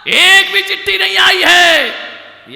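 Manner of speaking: high, loud, slow insistence; loud protest. Acted. Seth protesting to Yamraj no letter came, loud. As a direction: plead your case at full volume.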